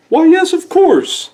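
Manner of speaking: put-on very high, dreamy old owl voice; a forgetful owl thinking aloud; Oscar Owl voice. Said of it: "said Oscar".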